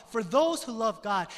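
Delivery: "those" lifted high and loud; stress on THOSE WHO LOVE GOD. Shown as a demonstration. Preacher pointing out the verse's key clause, emphatic. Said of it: "put emphasis on that clause".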